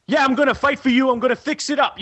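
tough New York politician. Retold or imitated. Mocking Weiner working the street crowd, tough New York voice. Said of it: "he does the tough, like, New York thing".

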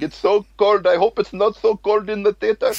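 high-pitched put-on Indian accent; imitated Indian accent. Indian moviegoers complaining about the cold, imitated in a high Indian accent. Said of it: "Indian people would always be like".

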